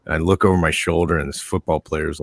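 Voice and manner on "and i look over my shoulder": ordinary delivery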